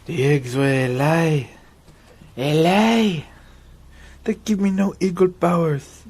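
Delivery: loud, high put-on character voice; movie quote voice. Barber quoting a film line in a loud funny voice.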